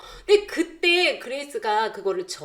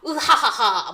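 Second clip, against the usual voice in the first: loud hammy pirate cackle; evil villain laugh; 4 dB louder, slower than usual. Retold or imitated. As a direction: throw out a booming villain laugh. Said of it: "연극하신 건가요".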